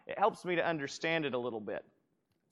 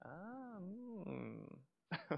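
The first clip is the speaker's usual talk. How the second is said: a breathy, near-silent gasp then a soft hmm; 3 semitones higher, 15 dB quieter than usual. Shown as a demonstration. Pastor demonstrating the scribes' silent inner gasp, near-soundless. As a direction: show a gasp kept inside. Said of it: "It was kind of a".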